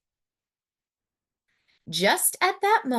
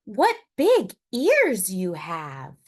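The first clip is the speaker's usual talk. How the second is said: put-on slow, louder girl's line; said slowly; 5 dB louder than usual. Little Red to the wolf in bed, slow and wondering. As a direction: draw it out carefully. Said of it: "she said slowly".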